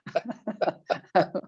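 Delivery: a long high giggle; high giggling laugh. Real, not acted. Co-host laughing at the host's early wake-up, high giggle.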